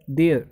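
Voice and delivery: "देयर" ending in a schwa, "dee-uh"; silent final R. Shown as a demonstration. Teacher models a diphthong word for students, silent R. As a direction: drop the R at the end of "देयर". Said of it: "रै pronounce नहीं करते".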